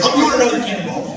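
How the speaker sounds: put-on high, loud, fast drunk boast; drunk bravado. Drunk guy telling crowd his plan, fast loud boast. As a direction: blurt out the next stunt.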